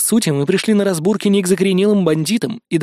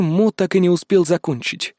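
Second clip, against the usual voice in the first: usual speaking voice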